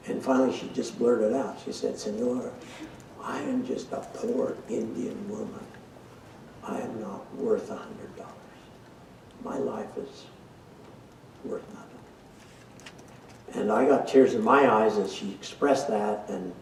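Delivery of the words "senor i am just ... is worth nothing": quiet, sorrowful retelling, fading almost to nothing; a poor woman softly confessing her worthlessness to the teacher; sad. Retold or imitated. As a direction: voice her shame softly, barely above a breath.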